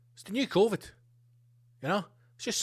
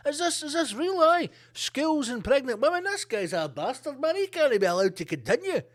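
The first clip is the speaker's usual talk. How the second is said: mock Biden; 8 semitones higher than usual. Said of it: "And he's like".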